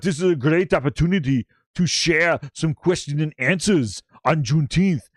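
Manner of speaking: mock Arnold accent, admittedly inexact; Pierre. Host retelling Pierre's Juneteenth announcement, mock Arnold accent. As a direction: put on a rough Arnold accent. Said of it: "Maybe not the same accent".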